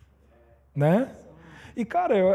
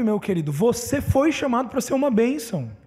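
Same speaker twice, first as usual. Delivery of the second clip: impassioned preaching, higher pitch; pregação inflamada; 4 semitones higher than usual. Acted. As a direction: exhort the congregation with rising conviction. (pregação enfática, voz mais aguda)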